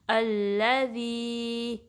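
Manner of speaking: slow drawn-out अल्लज़ी with fatha on hamza; recitation demo. Shown as a demonstration. Teacher to students, showing hamza with fatha. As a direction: recite अल्लज़ी slowly, opening on a short a. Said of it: "उसको इस तरीके से पढ़ेंगे".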